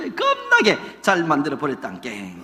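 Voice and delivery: playful Jeolla dialect punchline; mock Jeolla lilt, falling at the end. Shown as a demonstration. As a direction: draw out the Jeolla ending. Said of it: "전라도 영어는 끝에 깽깽만이야".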